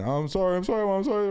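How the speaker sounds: put-on high, frantic kid apologizing; panicking kid. Dad imitating his son caught losing his phone, panicky and high. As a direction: squeak out panicked apologies like a scared boy. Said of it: "he started panicking".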